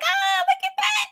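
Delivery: very high, mock-thrilled squeal; excited squeal. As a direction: squeal like you've spotted it in the street. Acts out spotting merch in street.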